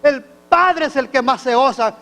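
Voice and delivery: loud, driving pulpit preaching; preaching at full force. Acted. Preacher to his congregation, loud fervent preaching. Preacher retells prodigal son.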